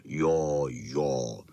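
deep, low dog rumble; big dog Bernardin. A narrator's reading. Bernardin agreeing with the pack, deep voice. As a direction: sink the voice right down. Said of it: "říkal Bernardin".

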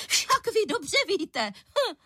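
slow, very high indignant accusation; huffy falsetto with a scornful "hm"; unnaturally high voice. A narrator's reading. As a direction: accuse in an offended high pitch. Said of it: "nepřirozeně vysokým hlasem".

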